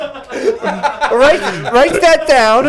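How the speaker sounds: high and very slow; mock commander order. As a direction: bark it like a pompous officer. Mock dictation.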